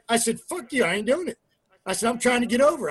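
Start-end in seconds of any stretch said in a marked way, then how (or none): 0.4-1.4 higher-pitched retort, replaying an argument; mock-indignant refusal, pitched up; retold defiant refusal. Retold refusal in locker-room argument. 2.1-2.9 higher, louder, arguing your case; raised-voice complaint, acted out; retold indignant protest. Retold protest in locker-room argument.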